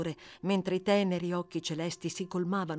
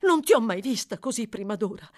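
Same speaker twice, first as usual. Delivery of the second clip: alarmed aunt; 4 semitones higher, 6 dB louder than usual. A narrator's reading. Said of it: "esclamò la signora abbracciandola".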